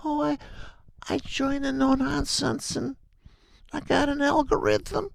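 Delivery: high, thin voice, mocking a rookie trader boasting; mocking a beginner who thinks an algorithm makes him safe; mock naive trader. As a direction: sing it up high like a clueless newbie bragging.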